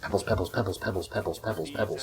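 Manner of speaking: soft, rattled-off plea; quiet urgent repeating. Real, not acted. Player calling Pebbles during a bad fight, quietly urgent. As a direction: repeat it urgently under your breath.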